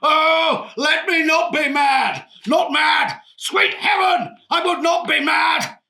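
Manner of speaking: huge, high theatrical shout; King Lear. Acted. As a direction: cry it out slowly like a tragic stage king. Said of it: "I went straight into my best King Lear".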